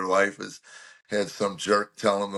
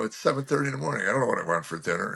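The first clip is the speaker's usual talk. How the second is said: quick irritated answer, rushed; fast grumpy retort; much faster than usual. Retold or imitated. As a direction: snap back fast, half annoyed. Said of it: "Why are you talking to me like that?".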